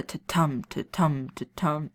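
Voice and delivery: ta-tum said with the second beat stressed each time; demonstrating the da-DUM rhythm of iambic meter. Shown as a demonstration. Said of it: "it stresses every other syllable".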